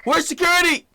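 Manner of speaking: shrieked high, panicked call for security; mock scream for security. Retelling the clip, a hammed-up shout for security. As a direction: screech it like she's panicking. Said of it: "She said".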